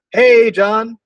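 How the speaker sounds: light up as he picks up; excited greeting. Shown as a demonstration. Sales caller greeting a prospect on the phone, excited. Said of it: "I'm excited".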